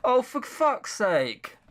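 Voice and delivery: slow, drawn-out exasperation; fed up with the game's writing; exasperated. Real, not acted. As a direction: groan it out fed up. Exasperated at game.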